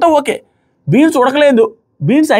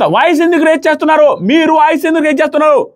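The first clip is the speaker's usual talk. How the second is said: mock indignant scolding; 7 semitones higher than usual. Imitating Madhuri's raised-voice scolding.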